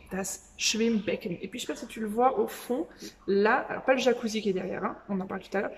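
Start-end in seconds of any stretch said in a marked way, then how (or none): 0.1-1.4 "das Schwimmbecken" drawn out slowly and clearly; teacher modelling a German word slowly; slow vocabulary demo. Shown as a demonstration. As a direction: present the new word slowly to the class. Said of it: "Je vais t'apprendre du vocabulaire".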